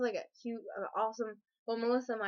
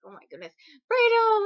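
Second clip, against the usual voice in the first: high loud excited call; excited squeal; 10 semitones higher, 8 dB louder than usual. Retold or imitated. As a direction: greet him like you can't believe it. Excited greeting of friend.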